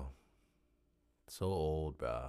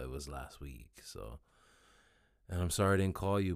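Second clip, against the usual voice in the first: ordinary talk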